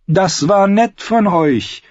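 high, slow mock-friendly; sweetly polite outlaw singer; a touch of false friendliness. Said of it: "antwortete der Sänger freundlich".

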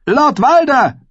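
high, loud cry of his name; scream. A narrator's reading. Mother with a hostage calls the lord, screaming. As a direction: yell to seize his attention. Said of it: "schrie sie".

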